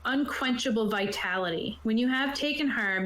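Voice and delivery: normal talking voice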